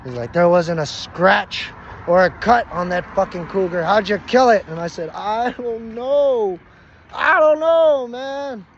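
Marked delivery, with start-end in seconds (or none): 0.3-4.7 loud, raised, emphatic questioning; incredulous demand. Retold or imitated. Astonished questioning about unmarked cougar. 5.1-6.6 very slow, high, stretched-out wail; baffled wail. Retold or imitated. Baffled answer to questioning. 7.1-8.7 high, loud cry of bafflement; helpless outcry. Retold or imitated. Baffled answer, escalated.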